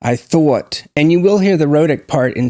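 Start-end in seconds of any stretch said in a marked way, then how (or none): 0.0-0.8 New York "thought" with a w-glide, thaw-uht; New York accent. Shown as a demonstration. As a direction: round and glide "thought" like a Northeast American accent. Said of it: "Northeast accent where you hear this".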